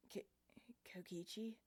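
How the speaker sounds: whispered, weak and hoarse; muttered. Acted. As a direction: barely voice the name. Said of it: "I muttered".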